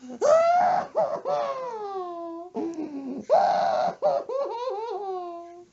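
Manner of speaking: high, loud hammed-up sob-laugh; mock wailing cry. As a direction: wail like a grieving old lady, overdone. Mock blubbering at fish funeral.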